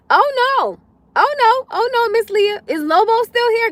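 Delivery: high, loud alarmed oh no; alarmed calling out. Real, not acted. Calling to viewer Lobo, high and alarmed. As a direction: call out in mock alarm.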